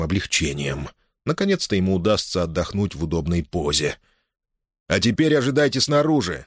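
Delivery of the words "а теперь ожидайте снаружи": higher, firm order; commanding. A narrator's reading. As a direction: order them out. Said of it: "скомандовал Алонсо".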